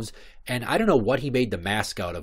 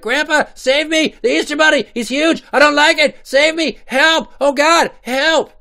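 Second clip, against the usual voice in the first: shrill panicked yelling, far higher and louder; a scared five-year-old screaming for help; terrified child scream; 11 dB louder than usual. Retold or imitated. Little boy running from the giant Easter bunny, panicked screams. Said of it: "I'm like".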